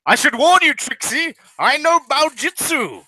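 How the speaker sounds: high-pitched British boast; big British guy. Acted. British mech pilot warns Trixie, boastful British accent. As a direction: warn them in a British accent. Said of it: "it's a big British guy".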